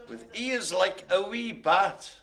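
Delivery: put-on slow, lilting Edinburgh accent. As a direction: put on a drawn-out Edinburgh Scots. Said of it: "did that in an Edinburgh accent".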